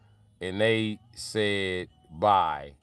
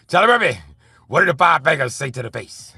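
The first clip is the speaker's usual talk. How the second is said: high, loud [name] sketch voice; loud, high mock [name]; 6 semitones higher, 8 dB louder than usual. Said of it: "Dawg said".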